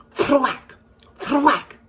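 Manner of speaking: loud high punchy thwack; chopping sound effect thwack. Acted. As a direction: smack each thwack like an axe blow. Chopping the beanstalk.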